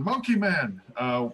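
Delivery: high-pitched call into the tavern; mock holler, drawn up high. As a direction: call it out without full volume. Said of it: "you call back into the tavern".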